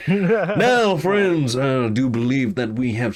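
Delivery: slow, grand self-satisfied hero; a hint of the showman; lightly voiced swaggering hero. Alador addresses the guild hall, lightly voiced and grand. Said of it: "Alador speaks again".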